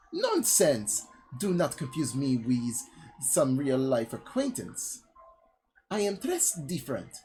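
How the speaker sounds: slow, hammed-up French accent. Disguised woman denying she is an acquaintance, mock French accent. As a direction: put on a halting, silly French accent. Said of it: "my voice just goes from French stereotype".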